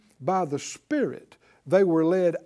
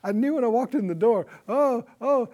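mock-gushing church newcomer, pitched higher; higher, hurried mock excitement; excited newcomer; 5 semitones higher than usual. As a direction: imitate someone thrilled to have found their church. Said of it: "hear the excitement in their voice".

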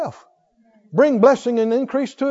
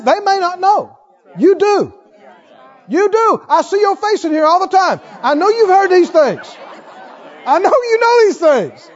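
higher, louder fervent preaching; 7 semitones higher, 6 dB louder than usual. Acted. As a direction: drive the point home to the congregation. Preaches at full force.

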